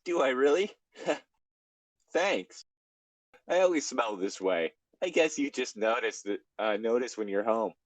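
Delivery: high-pitched, nervously laughing character voice; nervous Tails. A narrator's reading. Tails answering Cream after being sniffed, nervous. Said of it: "Tails laughed all nervously and said".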